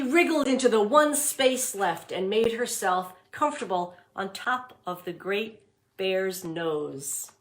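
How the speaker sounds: put-on read-aloud voice for young children; storybook reading. A narrator's reading. Teacher reading The Mitten to class, storybook voice. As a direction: narrate it warmly for the class.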